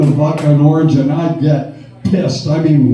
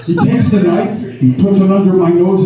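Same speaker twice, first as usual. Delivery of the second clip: ordinary talk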